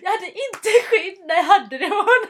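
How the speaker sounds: laughing through an embarrassed confession; giggling loudly while admitting it. Real, not acted. Admitting a slip to her friend, laughing loudly.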